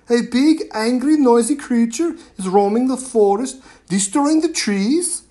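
high, loud, breathless alarm; urgent warning. A narrator's reading. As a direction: burst in warning the chief. Girl warns chief urgently.

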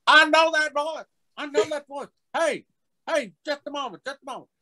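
very high-pitched excited holler; imitated bus passenger. Fan hails actor from back of bus, excited high holler. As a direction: call out from the back in a squeaky high voice. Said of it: "from the back of the bus".

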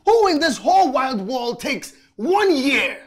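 incredulous, high, loud outburst; shouting. Acted. Man challenging her year of indecision, angry shouting. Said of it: "You're shouting".

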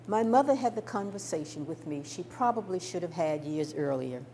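plain reading voice

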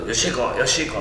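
softly imitated Akita dialect; quiet, low take on an Akita grandmother. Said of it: "秋田弁ばーって喋って".

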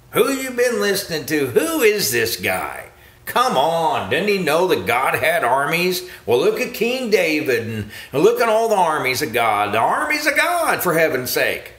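indignant preacher. Retold or imitated. Preacher questioning a member about this guy, mimicked indignation. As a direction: imitate an exasperated preacher, pitch climbing to a peak. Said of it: "they go talk to their preacher".

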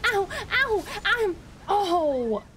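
high, rapid-fire yelps ending in a wail; yelps of pain. Real, not acted. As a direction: yelp at each hit. Taking repeated hits in game.

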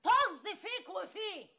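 slow, loud, high scornful put-down; spitting contempt at the guest; contemptuous dismissal. Acted. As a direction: drag each word out with scorn. Wife dismisses him with contempt.

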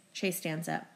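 plain reading voice

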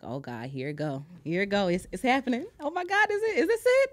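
winding yourself up until the last question almost squeals; building excitement. Retold or imitated. Waiting at her desk for the producer's call, rising panicky excitement.

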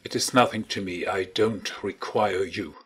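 cold and dismissive, a touch quick; coldly. A narrator's reading. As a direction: brush him off without feeling. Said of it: "said Campbell coldly".

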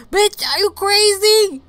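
shocked shout. Real, not acted. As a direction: yell it in disbelief. Shocked outburst at game scene.

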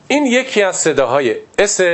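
ordinary talk, nothing marked

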